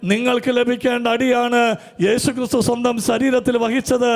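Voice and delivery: high, loud, fervent preaching cadence; full-force preaching. Acted. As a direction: preach it out to the whole hall with fire. (പൂർണ്ണ ആവേശത്തോടെ ഉച്ചത്തിലുള്ള പ്രസംഗം)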